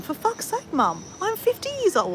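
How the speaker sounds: high, exasperated whine; fed-up daughter insisting on her age; telling mum she's fifty, annoyed; annoyed protest. Retold or imitated. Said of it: "I was so annoyed".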